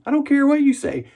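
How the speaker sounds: high-pitched, indignant correction; mock indignation. [name] scolding viewers over a mispronounced name, mock indignant. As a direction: protest it high, playfully outraged.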